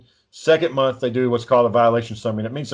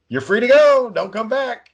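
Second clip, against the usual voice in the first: high, breezy mock dismissal; mock send-off; 10 semitones higher than usual. Brother imitating officials freeing a probationer, mock send-off. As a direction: send them off in a raised sing-out voice.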